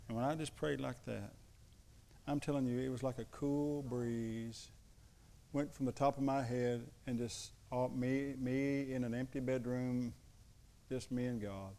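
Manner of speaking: usual speaking voice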